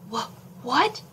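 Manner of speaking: jolted out of sleep, stammering; startled gasp. Acted. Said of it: "Noah gasped".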